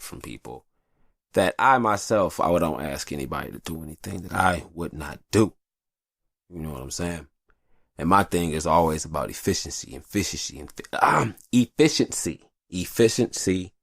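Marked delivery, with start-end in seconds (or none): none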